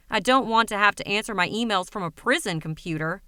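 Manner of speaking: ordinary delivery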